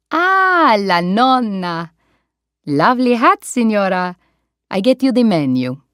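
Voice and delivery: put-on a playful Italian accent, high and friendly; Italian waiter. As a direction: greet the old lady like a warm Italian waiter.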